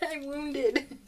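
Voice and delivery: giggling through a fake whine; playful laughing lament; laughing mock complaint. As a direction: laugh it off as if wounded. Mock complaint about hurt hand.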